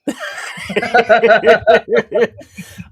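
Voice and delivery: a high, loud burst of laughter; big loud laugh. Real, not acted. Co-host laughs hard after his toilet joke.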